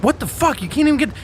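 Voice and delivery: high, outraged retelling of the brother; imitating an angry brother; mock outrage. Retold brother yelling at nurse.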